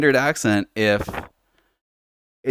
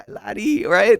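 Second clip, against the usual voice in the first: Irish laddie; 8 semitones higher, slower than usual. Shown as a demonstration. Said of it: "They say laddie".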